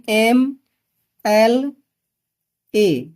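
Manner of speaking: letter by letter, long pauses between; sounded-out pronunciation. Shown as a demonstration. Teacher showing students how MLA is pronounced, spelled out slowly. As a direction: say एम, एल, ए as three separate, spaced-out sounds. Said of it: "pronunciation करेंगे".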